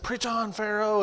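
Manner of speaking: high, drawn-out heckling cry; visitor's call-out. Retold or imitated. Visitor calls out once more.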